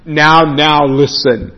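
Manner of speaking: slow, indulgent, drawn-out soothing; mock reassurance. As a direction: humour them with a chuckle, stretching the words. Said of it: "and you say to them".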